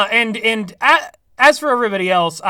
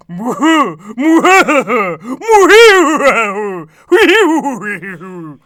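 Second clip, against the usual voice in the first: evil laugh; 5 dB louder, much slower than usual. Retold or imitated. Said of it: "he's like".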